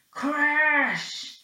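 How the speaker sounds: shouted crash sound; big crashing sound effect; loud crash. A narrator's reading. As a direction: bang it out like a crash.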